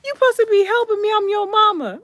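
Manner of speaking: high, loud, rapid protest; hammed-up frantic outrage; indignant yell. Mother scolding son for not helping, indignant.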